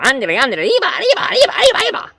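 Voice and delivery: very high, loud excited yell; squeaky Mexican mouse yell; Speedy Gonzales. Retold or imitated. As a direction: shout arriba like a speedy mouse. Speedy's cry.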